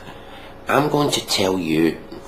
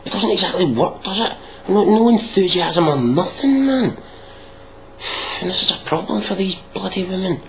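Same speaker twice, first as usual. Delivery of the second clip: higher, incredulous and mocking; pitched-up disbelief; exasperated; 12 semitones higher than usual. Real, not acted. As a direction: scoff at the flat delivery. Exasperated rant at the clip.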